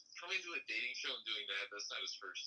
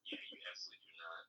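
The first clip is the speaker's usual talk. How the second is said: whisper; 3 semitones lower, 6 dB quieter, much faster than usual. Real, not acted. Mocking aside about Sheridan.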